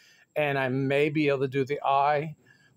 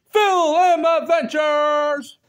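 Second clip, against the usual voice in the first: slow, high, loud drawn-out names; channel shout-outs; 9 semitones higher, 6 dB louder, much slower than usual. Acted. Vlogger giving channels shout-outs, slow and loud. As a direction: stretch each channel name into a big call.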